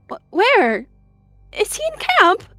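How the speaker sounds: loud, very high, cracking cry; stammer, then cry out high and distraught; voice cracking, tearful. A narrator's reading. She-cat asking where the dead cat is, voice cracking. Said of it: "Her voice cracked, tears swelling".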